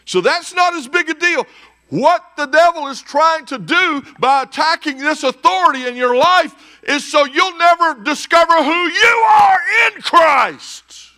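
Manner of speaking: loud, high preaching that swells to a shout; full-force preaching. Real, not acted. Preacher warning the church about the devil, full force. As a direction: drive it home like a preacher.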